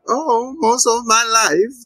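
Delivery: a hammed-up kindly granny offering cookies; sweet old grandma. Grandma voiced in a recap.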